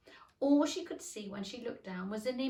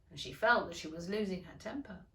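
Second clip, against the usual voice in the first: ordinary delivery, nothing marked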